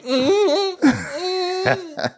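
very high smug little hum; Daemon. Acted. Prince holding the egg, high bratty hum. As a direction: hum high, clutching the prize. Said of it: "how Daemon's holding that egg".

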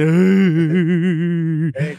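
long, loud imitated wail; hammed-up bawling on one drawn-out vowel; mock loud crying. Retelling himself as a scared kid, mock loud wail. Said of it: "llorando, pero fuerte".

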